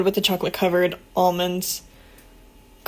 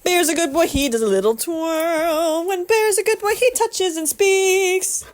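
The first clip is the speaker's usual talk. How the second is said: sing-song dog talk; 7 semitones higher than usual. Real, not acted. Owner cooing tricks to her dog Bear, high sing-song.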